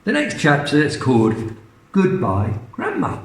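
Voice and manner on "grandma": usual speaking voice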